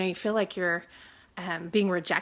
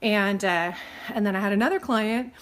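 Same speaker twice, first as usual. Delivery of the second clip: usual speaking voice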